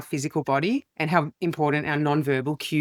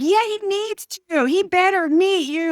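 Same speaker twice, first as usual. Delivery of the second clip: high, eager hyped-up voice; mock excited insistence; excited coworker quote; 12 semitones higher, 3 dB louder than usual. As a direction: pitch it way up like a thrilled fan.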